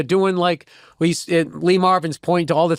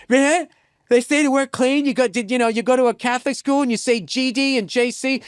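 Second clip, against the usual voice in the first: higher-pitched complaining voice; imitated scolding woman; 7 semitones higher than usual. Booker scolding the comic after he gets offstage, imitated higher voice. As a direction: mimic her telling him off. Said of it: "she's just all like".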